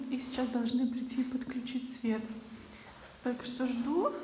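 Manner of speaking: ordinary talk, nothing marked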